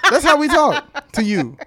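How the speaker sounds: high, slow, laughing through the words. Real, not acted. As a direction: say it while cracking up. Mocking her take on men.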